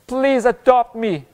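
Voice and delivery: high, slow plea; acted begging, pitched high; pleading prayer. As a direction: plead with a drawn-out please. Voicing a begging prayer to God.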